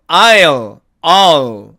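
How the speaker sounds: "I'll" as one gliding syllable, dark L left open; contracted I'll. Shown as a demonstration. Teacher modelling I'll for learners, contracted. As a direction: say "I'll" like "ai-o" shrinking to "al". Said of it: "이렇게 발음하고요".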